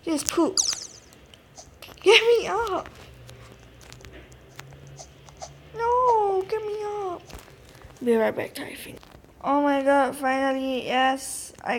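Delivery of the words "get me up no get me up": frustrated whine. Real, not acted. Stuck while parkouring.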